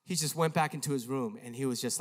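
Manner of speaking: normal talking voice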